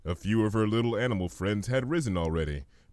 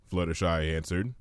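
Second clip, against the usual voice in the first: plain reading voice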